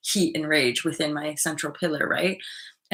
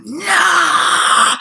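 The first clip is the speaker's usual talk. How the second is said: sharp, loud, high shout; loud refusal; 11 semitones higher, 10 dB louder than usual. Retold or imitated. Refusing the friend's demand, loud high shout. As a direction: shut them down hard.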